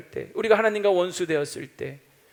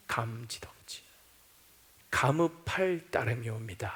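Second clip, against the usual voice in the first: much softer, lower, murmured gratitude; hushed awe; 7 semitones lower, 9 dB quieter than usual. Acted. Preacher murmuring thanks to God, hushed and low. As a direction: breathe it out as humble thanks. Soft awed confession to God.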